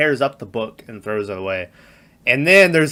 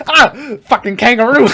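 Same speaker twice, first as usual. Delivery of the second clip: much higher and louder exasperated cry; imitating a man yelling at a kangaroo; mock frustrated yell; 10 semitones higher, 7 dB louder than usual. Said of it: "And he's like".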